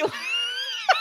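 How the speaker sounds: shrieking laugh breaking out. Real, not acted. Cohost hearing the absurd reply, bursting into laughter. Hosts cracking up over plot.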